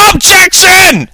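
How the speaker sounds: high, drawn-out dramatic shout; shouted objection. A narrator's reading. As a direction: shout it slowly at the top of your range. Lawyer's final joke objection.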